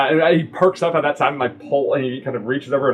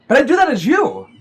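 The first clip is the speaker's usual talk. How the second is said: pointed encouragement; 12 semitones higher, 5 dB louder than usual. Acted. Character contrasts herself with adventurer.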